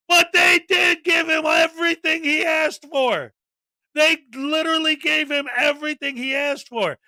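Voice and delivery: exasperated insistence. Real, not acted. Host arguing with the auditor's claim, exasperated. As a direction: push it out high, really exasperated.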